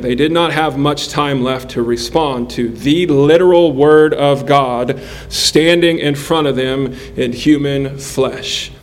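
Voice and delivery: usual speaking voice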